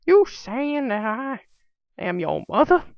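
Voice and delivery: high, loud, slow mock Southern teacher; Southern accent. A teacher offended at being called Mom, in a high Southern accent. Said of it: "heavy, like, southern accent".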